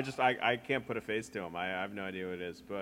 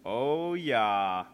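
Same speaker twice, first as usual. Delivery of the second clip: Minnesota accent; 7 dB louder than usual. Shown as a demonstration. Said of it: "we will be saying".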